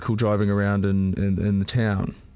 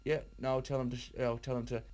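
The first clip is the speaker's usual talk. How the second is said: much softer, as if talking into another phone; pretend aside; 8 dB quieter than usual. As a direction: feign a hushed side conversation with another caller. Fake aside to imaginary other caller.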